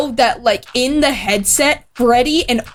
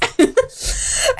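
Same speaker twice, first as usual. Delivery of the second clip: sudden high giggle burst; real high giggle at own story; high-pitched giggle; 13 semitones higher than usual. Real, not acted. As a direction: let a squeaky laugh escape.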